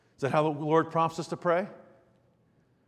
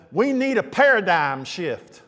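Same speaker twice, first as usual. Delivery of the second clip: put-on slow, drawn-out southern accent; slower than usual. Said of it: "with a southern accent".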